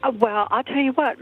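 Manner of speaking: ordinary talk, nothing marked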